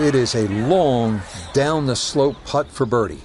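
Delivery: ordinary talk, nothing marked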